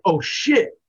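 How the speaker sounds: high, loud mock shock; shocked realization. Viewer catching what the bad guy says, acted shocked realization. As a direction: blurt it as the clue lands, pitch jumping up.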